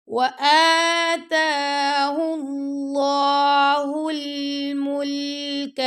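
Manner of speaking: slow, melodic tajweed chant; teacher chanting a verse for students; Quran recitation. Shown as a demonstration. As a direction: recite it slowly with full tajweed.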